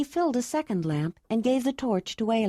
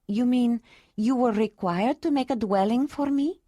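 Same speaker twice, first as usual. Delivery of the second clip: woman's voice; 4 semitones higher than usual. A narrator's reading. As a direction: answer gently as the woman. Ayla questioning.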